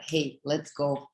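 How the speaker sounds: normal talking voice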